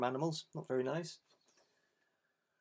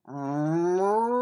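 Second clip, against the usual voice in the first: loud cow moo; 2 semitones higher, 9 dB louder than usual. Acted. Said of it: "Then the cow said".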